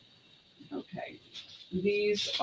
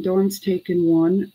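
normal talking voice

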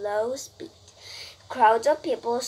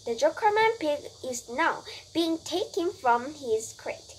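ordinary delivery

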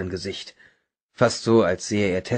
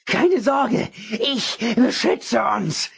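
zealous protector; 12 semitones higher, much slower than usual. A narrator's reading. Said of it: "Stimme immer lauter und wütender".

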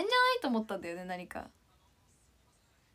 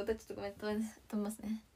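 whisper; 5 semitones lower, 10 dB quieter, faster than usual. Real, not acted.

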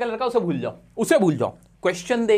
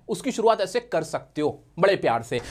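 normal talking voice

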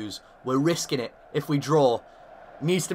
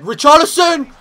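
name yelled much higher and louder; goal scream; 13 semitones higher, 13 dB louder than usual. Real, not acted. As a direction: shout the scorer's name. Goal celebration.

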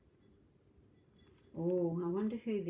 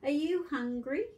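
lightly voiced boy, higher; a kind little boy asking; 7 semitones higher than usual. Grandma reading, boy asks kitten, lightly voiced boy. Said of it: "said a boy".

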